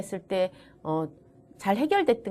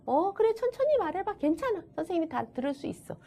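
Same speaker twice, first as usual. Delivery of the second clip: reassuring; 8 semitones higher than usual. Acted. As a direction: gently encourage a struggling child to take their time. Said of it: "이렇게 말을 한다라든가".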